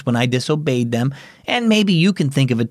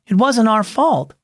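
put-on higher boy voice, defensive; boy making excuses; 7 semitones higher than usual. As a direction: shift the blame like a kid. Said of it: "Danny said".

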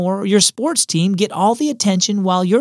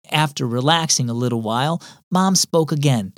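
usual speaking voice

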